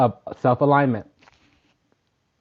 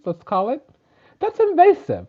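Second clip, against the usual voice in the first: much higher, squealing mock indignation; mock outrage; 12 semitones higher, 4 dB louder than usual. Mocking a spirit barging into the bathroom, high squeal. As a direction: squeak it out, scandalised.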